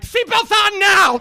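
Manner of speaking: high, slow intercom shout; drawn-out shouted command; barked pilot command. Acted. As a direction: order the cabin at full volume. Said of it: "I want the pilot to get on the intercom".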